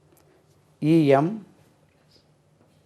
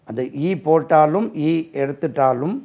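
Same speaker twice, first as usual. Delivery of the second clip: usual speaking voice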